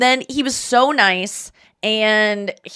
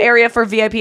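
usual speaking voice

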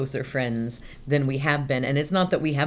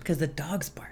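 whispering; 7 dB quieter than usual. Real, not acted. Quiet aside about barking dogs.

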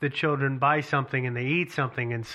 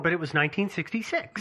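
normal talking voice

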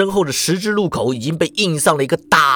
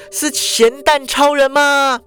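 put-on high, slow hopeful girl's voice; high girl's voice, drawn out; Shuhua asking; 10 semitones higher, slower than usual. A narrator's reading. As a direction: ask it slowly, half hoping. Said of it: "淑华就问说".

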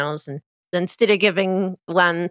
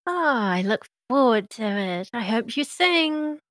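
playfully nudging him to sing; cheerful Meadow; 3 semitones higher than usual. Acted. Meadow cheerfully urging singing.

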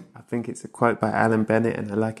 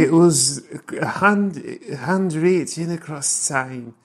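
slow, drawn-out and poetic; savouring the line slowly; dreamy slow reading; 3 semitones higher, slower than usual. Acted. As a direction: linger over each word like a quote read aloud. Dreamily re-reading the Bennett quote.

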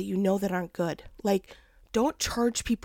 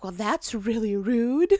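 mock offended; slower than usual. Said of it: "And I was like".

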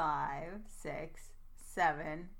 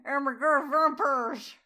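loud, slow, slurred silly voice; Van Helsing; 4 semitones higher, 11 dB louder than usual. Pretend. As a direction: garble it loudly in a goofy character voice. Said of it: "that's the new Van Helsing voice".

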